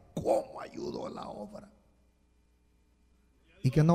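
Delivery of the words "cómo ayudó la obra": breathy, whispered marvelling; hushed admiration. Acted. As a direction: whisper it in fond amazement. Imagined congregation fondly recalling a helper.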